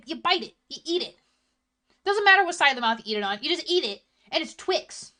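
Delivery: indignant, high-pitched disbelief; heated rant. Real, not acted. Streamer argues with chat over which side to eat Twix on, heated. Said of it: "I'm sorry I got so heated".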